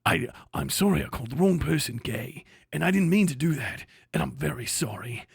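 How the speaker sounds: low, soft mocking apology voice. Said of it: "the whole apology is".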